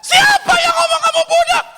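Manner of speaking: very high, loud outraged yell; furious scream. Acted. Manado mother enraged for her child, screaming. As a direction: explode into a high-pitched scream. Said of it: "langsung dia teriak".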